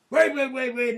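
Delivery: loud, high frantic wait; a mock alarmed guard yelling; panicked shout. The fed sees him unzip, a high loud yell.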